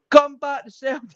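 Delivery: high-pitched pleading holler; mock fan's call across a terminal; imitated calling out. Fan calling a player back to Celtic, mock holler.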